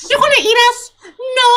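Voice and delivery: squeaky little monster voice; creature voice. Acted. GM voices the flumps.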